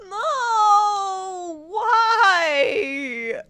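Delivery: loud, high mock-crying wail; whiny toddler. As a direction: wail it like a little kid throwing a fit. Keeps up the toddler act.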